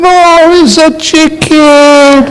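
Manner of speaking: high, slow, whimpering protest; tearful wife. Acted. Wife near tears insisting to husband, high and slow. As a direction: squeak it out as if about to cry. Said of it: "And she began to cry".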